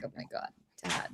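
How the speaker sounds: exasperated whisper. Retold or imitated. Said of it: "And I'm just like".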